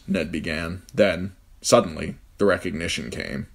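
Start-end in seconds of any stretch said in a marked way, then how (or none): none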